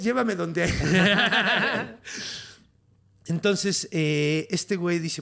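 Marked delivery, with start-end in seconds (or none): 0.8-2.5 hearty laugh. Real, not acted. Laughing at own story.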